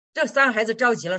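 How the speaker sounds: normal talking voice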